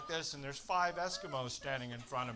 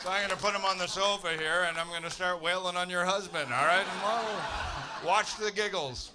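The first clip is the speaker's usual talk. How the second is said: loud, higher, gleeful threat; mock threat; 6 semitones higher, 11 dB louder than usual. To the smug couple, a loud gleeful mock threat. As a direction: shout it out like a gleeful maniac.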